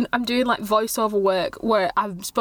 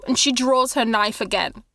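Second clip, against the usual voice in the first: ordinary talk, nothing marked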